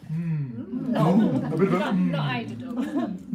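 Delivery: murmur of delight. Shown as a demonstration. Host shows audience how to applaud a poem, delighted murmur. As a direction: murmur appreciatively, drawn out. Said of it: "everybody murmurs with delight".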